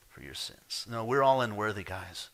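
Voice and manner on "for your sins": low breathy whisper of the phrase; whispered repetition; whispered echo. Real, not acted. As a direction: drop to a near whisper on for your sins. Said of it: "repeated as whisper".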